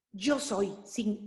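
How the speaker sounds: normal talking voice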